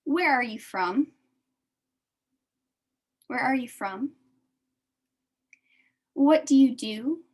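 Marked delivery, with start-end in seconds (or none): none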